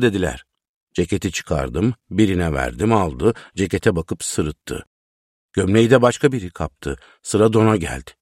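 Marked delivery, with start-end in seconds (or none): none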